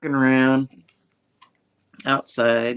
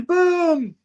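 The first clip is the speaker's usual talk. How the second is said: much higher, loud "boom"; big boom; 7 dB louder than usual. Retold or imitated. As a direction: punch it like a comic sound effect. Sound effect in retelling.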